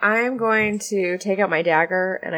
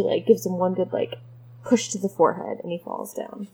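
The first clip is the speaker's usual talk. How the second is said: ordinary talk, nothing marked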